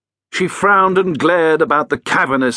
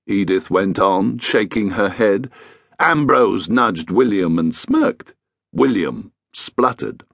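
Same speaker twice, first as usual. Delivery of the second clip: usual speaking voice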